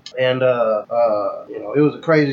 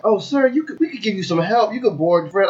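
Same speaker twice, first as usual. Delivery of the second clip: higher, eager-to-help staff voice; helpful airline staff; 6 semitones higher than usual. Acted. Guest mimicking airport staff offering help, obliging higher voice. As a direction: imitate an obliging gate agent, pitched up. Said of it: "And then they're like".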